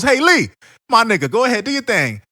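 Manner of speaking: loud, high hyped-up teacher cheering Lee on; Guy Sensei voice. Retold or imitated. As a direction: hype him up like an excited sensei. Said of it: "Guy Sensei hits the thumbs up and he goes".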